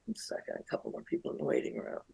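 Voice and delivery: hushed, hurried aside off-mic; whispered aside. Real, not acted. Turning away to tell a co-host about the waiting room, in a hushed rush. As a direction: whisper a quick word to someone nearby, then back.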